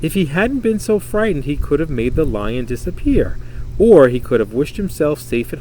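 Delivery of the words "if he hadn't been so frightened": ordinary delivery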